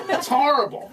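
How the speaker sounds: ordinary talk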